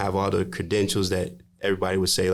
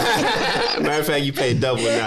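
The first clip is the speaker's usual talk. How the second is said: mock staff voice; 6 semitones higher, 4 dB louder than usual. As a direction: drag it out as a smug clerk.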